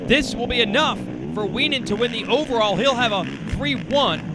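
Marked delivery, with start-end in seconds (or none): 0.1-2.1 motorsport commentator. Acted. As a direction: call the winning pass. Live race call of the pass.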